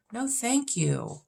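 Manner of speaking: drawn-out dismissive no thank you. Real, not acted. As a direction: stretch it out high and turn it down flat. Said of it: "dismissing rom-coms".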